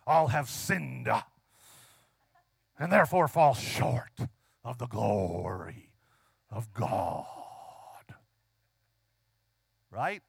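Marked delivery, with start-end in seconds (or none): 0.1-8.1 slow, low mock preacher boom, sinking deeper; imitated hellfire preacher. Mimicking preachers who love this verse, deep preacher voice. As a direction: drag the words out like a portentous preacher. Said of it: "some preachers really like to preach this".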